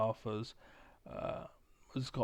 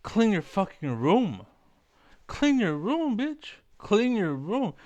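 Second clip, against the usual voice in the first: high, loud scolding quote; mock stern lecture, pushed high and loud; Jordan Peterson; 8 semitones higher, 5 dB louder, slower than usual. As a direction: order it with exaggerated force, pitch climbing. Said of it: "This is why Jordan Peterson says".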